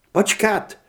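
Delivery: sudden high loud outburst; blurted out. Acted. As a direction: blurt it without warning. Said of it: "vyhrkla Heather tak nečekaně".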